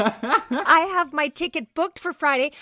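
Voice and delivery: slow and laughing, mock indignant; laughing protest. Real, not acted. Host pushes back on spoilers, laughing and slow. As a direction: laugh while insisting.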